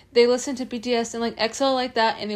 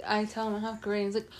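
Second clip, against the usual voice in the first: usual speaking voice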